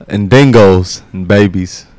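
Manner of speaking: slow, loud put-on Aussie accent; demonstrating a slow Australian accent. Shown as a demonstration. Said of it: "This is an Australian accent".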